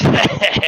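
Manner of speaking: rough unvoiced cackle; gritty cackle. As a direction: cackle at your own joke. Guard laughs at own joke.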